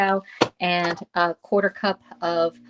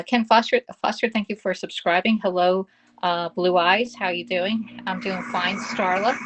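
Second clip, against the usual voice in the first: usual speaking voice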